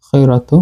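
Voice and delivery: "খৈরাতু" with a heavy, full-mouthed kh; thick kha. Shown as a demonstration. Teacher demonstrating the heavy letters, "খৈরাতু" with thick kha. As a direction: say the খ in "খৈরাতু" thick, not thin. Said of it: "আমরা পুরের মতোই উচ্চারণ করছি".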